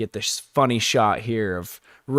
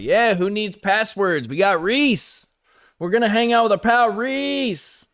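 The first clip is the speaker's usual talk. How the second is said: put-on excited drunk; 8 semitones higher than usual. Drunk Rick excited about Reese.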